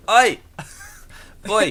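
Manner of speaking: loud, high London shout; hammed-up London "oi"; proper London accent. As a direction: call out like a rough Londoner. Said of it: "Just some proper London accent".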